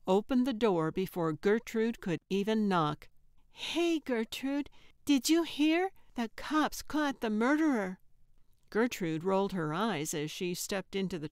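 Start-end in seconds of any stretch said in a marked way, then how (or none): 3.5-8.0 put-on high, eager kid's voice; excited child. A narrator's reading. Carl greeting Gertrude with news, excited child. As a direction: pipe up like a boy with big news.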